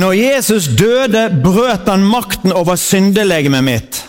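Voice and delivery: slow, proclaiming call for the congregation; preacher leading a spoken creed; leading a confession. Acted. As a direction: declare it for them to echo. Leads confession to repeat.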